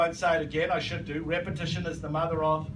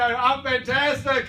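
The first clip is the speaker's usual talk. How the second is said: higher, stretched "fantastic"; upbeat greeting; 5 semitones higher, slower than usual. Acted. Speaker shows recruits greeting doubters, upbeat and stretched. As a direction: play an over-keen upbeat reply.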